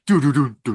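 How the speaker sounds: imitated teleport noise. Co-host asking about beaming down, mimicked teleport noise. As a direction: voice the transporter effect. Imitates beam-down sound effect.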